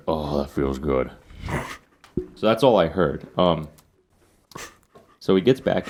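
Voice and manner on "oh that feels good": a hint of a low, slow groaning old biker; lightly voiced, lower and slower relief; mildly imitated low sigh of relief. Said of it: "a groan and".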